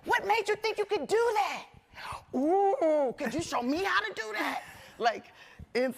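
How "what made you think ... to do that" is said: high, loud, gushing curiosity; breathless fan hanging on every word; eager curious questions. Retold or imitated. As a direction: pile on the questions, wide-eyed and thrilled. Mimicking eager questions to people.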